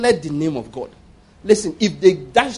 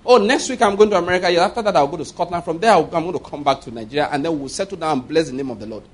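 fast, carefree travel boast; breezy bragging; 3 semitones lower, faster than usual. Retold or imitated. Preacher openly announcing his travels, fast carefree boast. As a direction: rattle it off casually. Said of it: "I will tell anybody".

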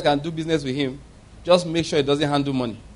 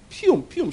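gunshot noises; 7 semitones higher, 3 dB quieter than usual. Retold or imitated. Imitating gunshots.

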